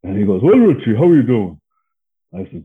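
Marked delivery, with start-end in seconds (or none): 0.4-1.6 higher, louder retold hello; mock cheery phone greeting; hearty greeting. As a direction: greet an old pal heartily, imitating him.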